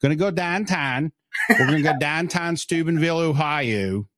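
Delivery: playful Pittsburgh Yinzer demo, downtown said "dahntahn"; Pittsburgh Yinzer accent. Shown as a demonstration. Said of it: "when he says O W N".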